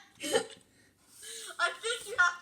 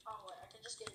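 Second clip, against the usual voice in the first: whispered; 5 semitones lower, 16 dB quieter, much faster than usual. Real, not acted. Sudden low aside.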